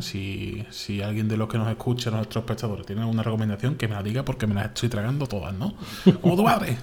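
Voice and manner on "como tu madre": a sudden high, louder "your mum" jab; cheeky comeback. Real, not acted. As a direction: fire off the joke with a teasing grin. Cheeky "your mum" joke interjection.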